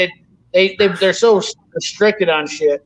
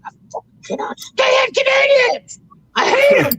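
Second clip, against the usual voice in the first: much higher, exasperated yelling; fed-up shout. Retold or imitated. Fed up after six hours of Canadian chatter, high exasperated shout. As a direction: shout it like you've had enough. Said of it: "I was like".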